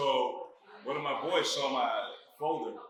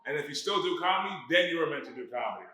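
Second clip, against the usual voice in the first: louder, higher plea; earnest warning; 5 semitones higher, 6 dB louder than usual. Real, not acted. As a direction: warn them loudly. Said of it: "I'm trying to save you".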